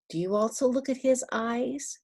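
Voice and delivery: ordinary delivery, nothing marked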